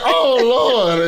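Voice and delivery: high, loud mock wail of Oh Lord; lady in church crying out to the Lord. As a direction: ham up a lady catching the Holy Ghost. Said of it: "she was like".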